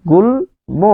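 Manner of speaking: "গুল্ম" slowly as gul... mo; syllable by syllable. Shown as a demonstration. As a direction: separate "গুল্ম" to show the final mo. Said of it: "সেখানে মো হয়ে যাবে".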